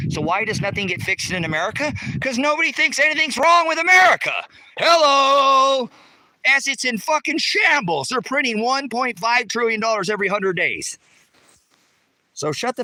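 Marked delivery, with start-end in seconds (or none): 2.2-10.3 high, much louder shout about the country; shouting rant. Real, not acted. Ranting about America.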